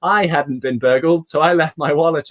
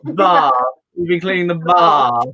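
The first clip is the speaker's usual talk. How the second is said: long open "bar" in a posh English accent, no r; mock posh English "bar"; 4 dB louder than usual. Shown as a demonstration. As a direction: say "We've been cleaning the bar" with a posh southern English a.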